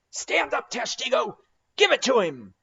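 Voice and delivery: high, loud command to strike; order shout. A narrator's reading. Stubb ordering Tashtego to strike, high loud command. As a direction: bark the order to throw. Mate orders harpoon thrown.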